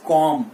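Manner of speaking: "comb" ending on M, kawm; silent B. Shown as a demonstration. Teacher correcting learners, comb said with silent B. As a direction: say comb without the B. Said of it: "B is silent".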